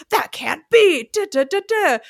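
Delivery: hams up an indignant customer pushing back; mock outrage. Imitating a customer who pushes back at city hall, mock outraged. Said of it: "get a little defensive and a little outraged".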